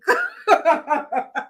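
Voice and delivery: real laugh after a fright; startled laugh. Real, not acted. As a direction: laugh off the shock. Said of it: "Oh, that was startling".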